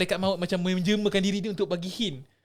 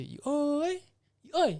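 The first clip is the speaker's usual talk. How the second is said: a drawn-out, higher eerie call of a name from behind the house; eerie ghost call; 8 semitones higher, 4 dB quieter than usual. Retold or imitated. Unseen spirit behind the house calling his name, eerie higher call. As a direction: call the name like an unseen spirit luring someone, a hint of spooky. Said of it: "Lepas tu dia kata".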